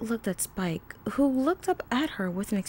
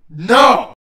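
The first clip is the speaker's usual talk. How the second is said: sudden very loud refusal; explosive, angry snap; snapped; 14 dB louder than usual. A narrator's reading. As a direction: cut them off with a shout. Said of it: "he snapped".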